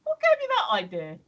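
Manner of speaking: imagined retort to the shop lad, half-sarcastic; sarcastic comeback. Pretend. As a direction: throw the question back with mock offence. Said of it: "should have turned to him and said".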